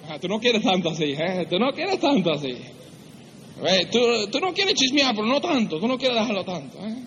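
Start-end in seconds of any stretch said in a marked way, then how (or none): none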